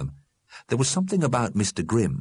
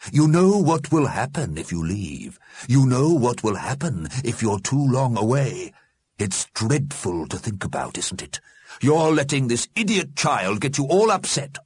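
higher, measured warning; admonishing Mister Grimm; 6 semitones higher than usual. A narrator's reading. Mister Grimm warns the dead against leaving, admonishing. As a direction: warn them with heavy emphasis.

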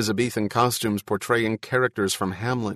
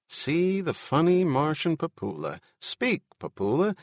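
high, sing-out coaxing that builds louder; showman's patter; 8 semitones higher, 4 dB louder than usual. Acted. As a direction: coax a performing creature for an audience.

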